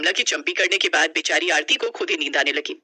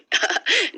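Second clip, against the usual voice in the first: amused laugh; 13 semitones higher than usual. Acted. Mother-in-law laughs at story.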